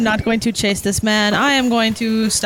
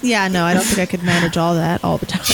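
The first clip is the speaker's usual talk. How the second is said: ordinary delivery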